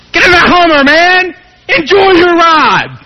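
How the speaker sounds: high, loud, drawn-out plea; exasperated shout. Retold or imitated. Friend yelling at the Hummer pusher, exasperated. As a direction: bellow it at the fool.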